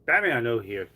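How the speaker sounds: ordinary talk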